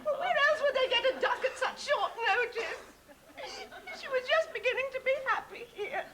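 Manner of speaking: tearful. Acted. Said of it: "I'm a bit emotional at the moment".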